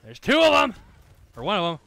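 high, loud alarmed call-out; excited shout. Real, not acted. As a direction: blurt it out in alarm. Spotting enemies mid-fight.

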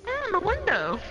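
mocking high echo.

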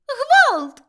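shrill, high cry for help; top-of-the-lungs alarm; frantic scream. A narrator's reading. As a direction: scream it out in panic. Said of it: "закричала Милашка".

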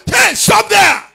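sudden high, loud demonstrated shout; father's shout. Acted. Father shouts at a foolish child, demonstrated loud command. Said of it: "you see father shout".

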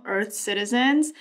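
ordinary talk

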